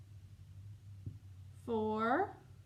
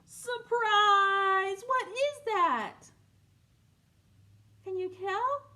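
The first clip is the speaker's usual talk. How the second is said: excited reveal; 10 semitones higher, 7 dB louder, much slower than usual. Acted. Big reveal to kids.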